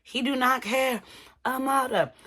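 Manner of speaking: hammed-up screaming to Amada; mock scream. Shay yells at Amada that Alan does not care, mock scream. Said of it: "Shay is screaming".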